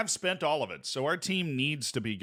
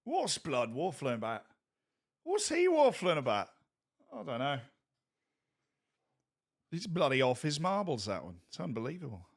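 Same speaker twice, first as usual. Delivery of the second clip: mock British accent. Streamer puts on a British accent, lightly mocking. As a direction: play a puzzled Brit, lightly voiced. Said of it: "see a British person saying that".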